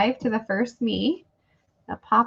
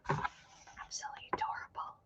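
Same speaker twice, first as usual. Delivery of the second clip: very soft adoring whisper; hushed, charmed aside; whispered admiration; 19 dB quieter than usual. Real, not acted. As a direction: whisper it as if to yourself. Admiring a cute book to herself.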